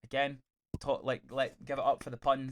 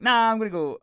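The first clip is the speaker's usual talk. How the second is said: higher and louder, a cheerful "Nah" of refusal; dismissive refusal; 9 semitones higher, 9 dB louder than usual. Retold or imitated. Vigilante telling his friends he's off, brightly. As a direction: brush the others off with a bright "Nah". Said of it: "And he's like".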